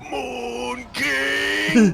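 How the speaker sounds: solemn proclaiming dwarf druid; druid dwarf proclamation. Retold or imitated. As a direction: boom out a dwarf king title. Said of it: "articulate my druid voice like a, a fucking dwarf".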